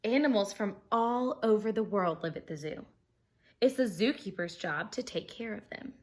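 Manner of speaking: bright, lively storybook reading; animated read-aloud for kids; reading aloud to children. Acted. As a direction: read it like story time for a class. Reading picture book to class.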